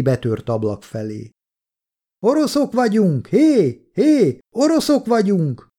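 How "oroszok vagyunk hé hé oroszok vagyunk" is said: high-pitched call through cupped hands. A narrator's reading. Kolja calls through the broken window to soldiers outside, shouting. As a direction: call out to unseen soldiers far off. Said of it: "oda kiáltott az ajtó melletti".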